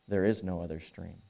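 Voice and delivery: low and quiet, a lion refusing gravely; low stern lion. Acted. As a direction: say it low and soft, an unbending lion. Lion answers Jill.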